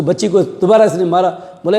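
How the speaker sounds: usual speaking voice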